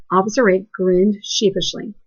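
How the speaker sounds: ordinary talk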